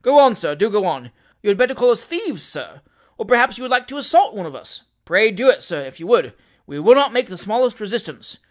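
loud, emphatic taunting; provoking lawyer egging on a victim; goading. A narrator's reading. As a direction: dare him with mock-polite insistence. Lawyer dares opponent to assault him.

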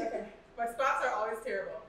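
usual speaking voice

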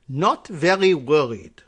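slow, heavy mock German accent on "not very worried"; put-on German accent. Shown as a demonstration. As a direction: say it like a German virologist, slowly. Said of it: "We'll say it like that".

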